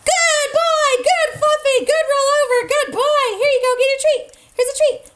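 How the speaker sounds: high, sing-song praise for the dog. Real, not acted. Praising the dog after a trick.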